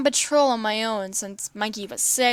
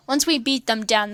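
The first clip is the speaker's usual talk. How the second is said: usual speaking voice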